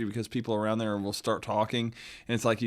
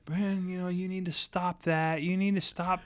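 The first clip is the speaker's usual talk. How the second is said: lightly voiced scolding mom; 6 semitones higher than usual. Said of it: "My mom".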